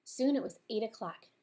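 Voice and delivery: usual speaking voice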